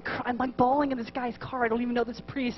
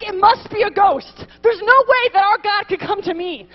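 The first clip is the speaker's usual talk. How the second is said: high, loud, insistent doubt; voiced disbelief; 6 semitones higher, 6 dB louder than usual. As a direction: cry out the doubt as a preacher voicing fearful disciples. Voicing the disciples' disbelief and fear.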